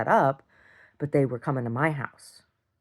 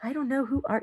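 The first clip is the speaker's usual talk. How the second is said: mock innocent neighbor; 11 semitones higher, 3 dB quieter than usual. Neighbor pretending not to know her, high mock voice. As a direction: play dumb in a high voice. Mocking Karen.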